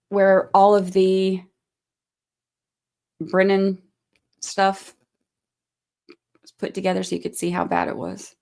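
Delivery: ordinary talk, nothing marked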